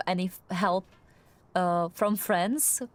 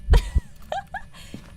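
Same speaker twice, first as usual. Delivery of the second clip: nervous laugh; 6 dB louder than usual. Real, not acted. Nervous laugh after the scare.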